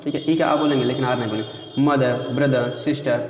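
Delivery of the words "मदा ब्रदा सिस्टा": "मदर, ब्रदर, सिस्टर" each ending in "aa"; र silent. Shown as a demonstration. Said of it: "ऐसे बोलते हैं". ("मदर, ब्रदर, सिस्टर" के अंत में आ)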